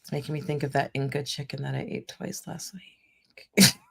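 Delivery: unvoiced craving whisper. Real, not acted.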